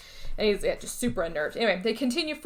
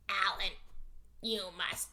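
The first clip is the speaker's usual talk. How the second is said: menacing whisper; 8 dB quieter, slower than usual. Retold or imitated. Creature looking up at Alan, threatening him, a dropping whisper. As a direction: let the threat sink into a whisper.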